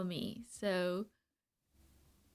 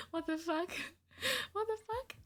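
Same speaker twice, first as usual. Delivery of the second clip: surprised; 10 semitones higher than usual. Real, not acted. Shocked reaction to chat.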